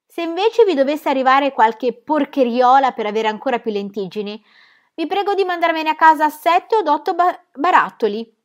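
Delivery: loud call from the doorway; shouted. Acted. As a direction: call it back across the shop. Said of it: "si arrestò e gridò".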